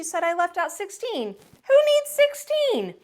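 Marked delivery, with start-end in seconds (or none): 1.7-2.9 high, slow, indignant squeak. A narrator's reading. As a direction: scoff at it like a cheeky kid. Defiant child retort.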